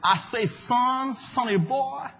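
high, long-drawn Texan holler; mock Texan drawl; Texan accent. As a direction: stretch it out like a hammed-up Texan. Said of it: "Knowing his Texan background".

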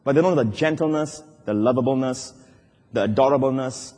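intimate, softened preaching; tender. Real, not acted. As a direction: soften right down after the shout. Contrasts God's gentleness with might.

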